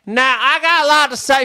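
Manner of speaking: brassy Louisiana lady holding court; imitating a loud Louisiana book club lady. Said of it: "She was real loud".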